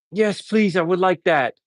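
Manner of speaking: high-pitched imitation of a patient accepting; eager patient. Agitated patient answers paramedic's offer of sedative, comically eager. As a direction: act out a grateful yes, pitched well up.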